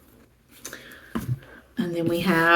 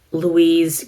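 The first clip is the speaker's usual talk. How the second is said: usual speaking voice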